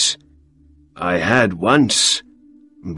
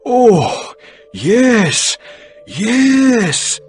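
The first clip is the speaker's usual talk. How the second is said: much higher, louder glad exclamation; delighted guest accepting the new goose; happily; 5 dB louder, much slower than usual. Acted. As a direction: burst out in relief and joy. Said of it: "said Mister Baker happily".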